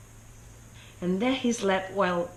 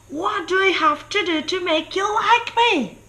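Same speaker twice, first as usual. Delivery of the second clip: much higher and louder, pleading yell; desperate shout; 11 semitones higher, 8 dB louder than usual. Acted. King Pig yelling at the ignoring sheep, high desperate shout. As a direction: bellow it, begging to be liked. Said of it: "he shouted".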